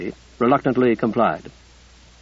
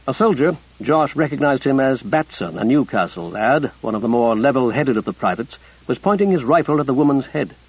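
ordinary delivery, nothing marked

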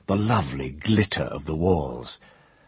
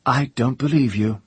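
trembling, louder denial; shaking voice; 7 semitones higher, 4 dB louder than usual. Acted. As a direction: let the voice quiver. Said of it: "and her voice shook".

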